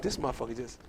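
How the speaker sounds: very low, quiet mutter; hushed disbelief. Retold or imitated. Silent crowd looking at the comic, hushed disbelief. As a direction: imitate a stunned onlooker muttering privately. Crowd's stunned thought, acted out.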